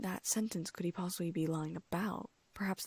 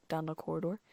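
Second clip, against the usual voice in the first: ordinary delivery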